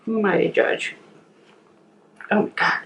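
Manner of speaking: ordinary talk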